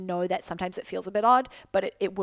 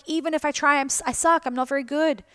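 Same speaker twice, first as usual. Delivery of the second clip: higher and louder, like the nagging voice in her head; higher, louder self-doubt voice saying she sucks; mock self-critical inner voice; 7 semitones higher, 5 dB louder than usual. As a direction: play the harsh inner critic, raised up. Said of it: "it's like".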